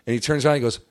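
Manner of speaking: normal talking voice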